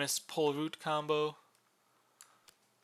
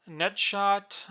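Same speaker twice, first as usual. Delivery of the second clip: called out higher and louder; loud announcement; 6 semitones higher, 10 dB louder than usual. Real, not acted. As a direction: announce it like a heading. Announces next arrow type.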